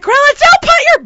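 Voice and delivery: high, loud warning cut short; exasperated yell. Retold or imitated. Paddler warning Grandma about the oar, exasperated yell. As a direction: shout to stop Grandma mid-blunder.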